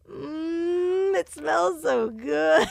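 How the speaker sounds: high, slow and pleased; little boy voice. Retold or imitated. Mom imitating her son's delight under the covers. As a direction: savor it like a proud small boy.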